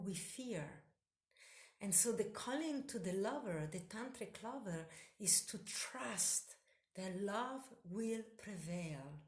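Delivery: ordinary talk, nothing marked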